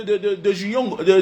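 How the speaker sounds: normal talking voice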